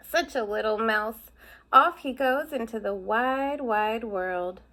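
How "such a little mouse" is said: ordinary delivery, nothing marked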